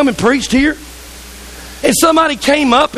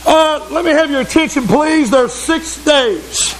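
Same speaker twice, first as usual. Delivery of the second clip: mock religious critic; 2 semitones higher than usual. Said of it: "And then one guy in the back".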